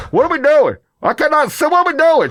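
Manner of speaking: high, loud panicked protest. Retold or imitated. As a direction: retell it as a shrill-pitched, alarmed outburst. Acting out panic in clamp.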